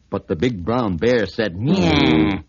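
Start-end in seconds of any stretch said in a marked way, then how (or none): 1.6-2.4 louder, low mock cat meow. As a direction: sneer a cat's meow. Bear mocks the elephant.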